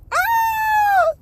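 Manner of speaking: high loud mock yell; hammed-up high scream. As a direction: scream like you got hit. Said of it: "he screamed".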